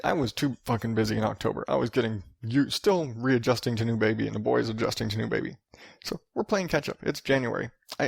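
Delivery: usual speaking voice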